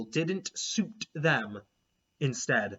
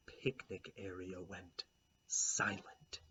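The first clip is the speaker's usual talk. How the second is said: hushed unvoiced whisper; 6 semitones lower, 13 dB quieter, slower than usual. A narrator's reading. Narrator hushes as the picnic goes silent, whispered. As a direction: drop to a breath.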